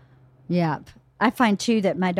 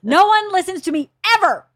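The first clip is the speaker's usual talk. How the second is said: high, loud hammed-up tirade; exasperated ranting; 8 semitones higher, 5 dB louder than usual. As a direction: wail in martyred fury at the household. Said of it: "I blew out my voice demonstrating".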